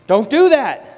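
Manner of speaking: high, loud warning shout; parent yelling. Acted. Parent shouting at child about to spill milk, loud. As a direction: stop the child with a sharp yell.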